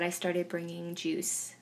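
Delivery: ordinary talk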